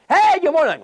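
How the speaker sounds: high, bright-eyed mock hello; cheery greeting. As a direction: hail them with exaggerated cheer. Acts cheerful greeting to grump.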